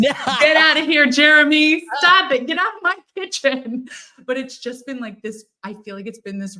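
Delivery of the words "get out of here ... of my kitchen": high, loud mock-stern shooing away; playful shooing. A woman shooing her late friend's spirit from her kitchen, loud playful mock scolding. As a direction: chase a pesky friend out of your kitchen, laughing at him.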